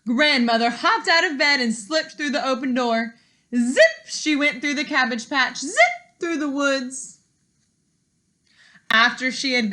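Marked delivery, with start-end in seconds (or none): 3.5-4.1 zip. A narrator's reading. Narrating Grandmother's escape. 5.6-6.2 zip. A narrator's reading. Narrating Grandmother's escape.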